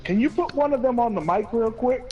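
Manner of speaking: nicest voice. Retold or imitated. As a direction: put on a friend's nicest voice. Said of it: "in his nicest voice".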